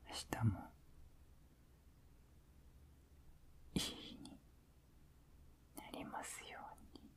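soft sleepy whisper; pillow talk. Acted. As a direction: whisper tenderly to drift off.

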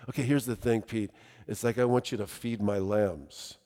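soft, low voiced Jesus; gentle instruction. Jesus tells Peter to feed his lambs, gentle low instruction. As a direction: confide the charge quietly, pitched low.

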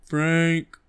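slow, dumb monster grunt-talk; lightly voiced lumbering monster; Frankenstein monster voice. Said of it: "And he'd be like".

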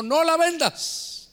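high, drawn-out, emphatic order; emphatic command. Retold or imitated. God forbidding a sister to sell her company, emphatic command.

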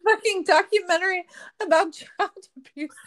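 high, loud, breaking up with laughter; real laughter spilling into the words; laughing. Real, not acted. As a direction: fight the giggles and lose. Breaking up laughing at dark topic.

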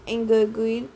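usual speaking voice

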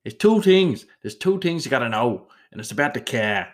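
put-on a shaky Boston Irish American accent that slides toward Irish. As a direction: bark it like a tough Boston local, high and loud at the start. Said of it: "That was a bit of a, that was a, um, not a very good one. That was more Irish than Irish American".

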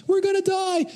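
very high-pitched comic squeal of dread; mock panic. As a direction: squeak it out like inner terror. Acted inner panic on boat.

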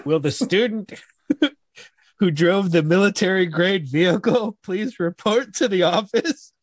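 high school PA announcement; mock principal on the loudspeaker; over the intercom.

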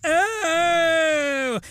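high, long "oh" of alarm; comic rising-panic howl; drawn-out realizing cry. Acted. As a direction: wail it as realization hits. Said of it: "takes a second for her to go like".

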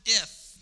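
"If" punched high and loud again; stressed if. Shown as a demonstration. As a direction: land "If" with the same sharp lift. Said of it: "Have you ever heard that".

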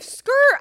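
high, loud mock screech of tires; imitated car skidding off. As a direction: peel out with a skid noise. Imitates tires peeling away.

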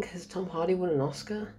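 normal talking voice

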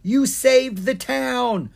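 high, loud, slow jubilant yell. A narrator's reading. Dwarf hailing the wizard as hero, yelling. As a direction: yell it out like a grateful crowd member. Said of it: "yelled to Ken".

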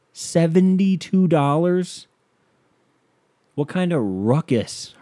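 high, slow, disbelieving; incredulous. Real, not acted. Ranting to listeners about the price, incredulous. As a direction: stretch it out in disbelief.

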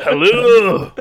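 high, loud hammy dwarf greeting; big put-on dwarf voice; video game dwarf. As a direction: call it out like a cartoon Scottish dwarf. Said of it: "World of Warcraft dwarf Thomas".

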